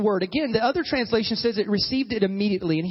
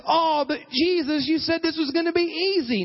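mock whiny complaint; 6 semitones higher than usual. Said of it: "They didn't die with this".

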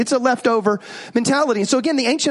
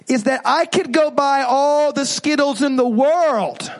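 usual speaking voice

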